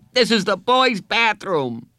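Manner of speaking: put-on very high, loud child's protest; angry outburst. A narrator's reading. Boy orders counselor out of bathroom, angry. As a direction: cry it out high to get them out.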